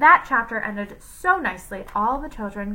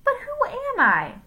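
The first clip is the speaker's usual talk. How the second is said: much higher, confused question; high puzzled voice. A narrator's reading. Jenny asking whose voice she has, high and puzzled. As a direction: wonder whose voice this is.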